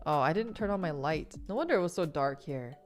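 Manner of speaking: ordinary delivery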